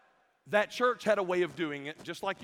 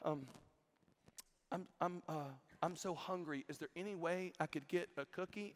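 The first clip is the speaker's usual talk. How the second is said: put-on soft, hesitant little girl asking; small, quiet mock-child plea to teacher; imitated little girl; 11 dB quieter than usual. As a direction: play a timid child, hushed and halting. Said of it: "this little girl walks up to a teacher".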